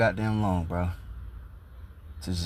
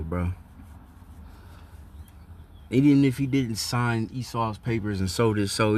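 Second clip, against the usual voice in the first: usual speaking voice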